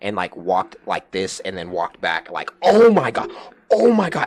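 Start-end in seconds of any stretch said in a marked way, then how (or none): 2.6-4.2 high, loud exasperated cry; losing it. Retold or imitated. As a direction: re-enact a loud outburst of disbelief. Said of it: "when I say lost it".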